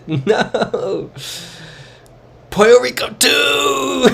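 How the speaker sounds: high, loud shriek of joyful disbelief, slow on Puerto Rico; delighted shout. Real, not acted. Streamer spotting a Puerto Rico sign, gleeful high shout. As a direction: squeal it out, thrilled and amazed.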